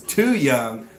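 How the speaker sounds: high, loud playful protest; mock indignation. Real, not acted. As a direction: object in exaggerated disbelief, pitch climbing. Mock protest at being called young.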